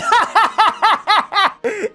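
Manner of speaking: squealing high laugh; high burst of laughter. Real, not acted.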